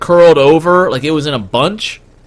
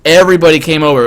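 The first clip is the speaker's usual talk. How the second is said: normal talking voice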